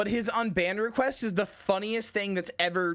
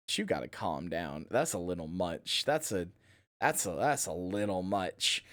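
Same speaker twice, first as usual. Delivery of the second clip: deep mock big-sister scolding; 11 semitones lower, 5 dB quieter than usual. Streamer scolding a horny chatter, voice much lower. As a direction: talk him down like a weary older sibling. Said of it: "And I'm like".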